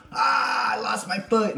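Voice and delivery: comic wail over a pretend injury; mock wail. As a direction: cry out in pretend agony. Acts out losing a foot.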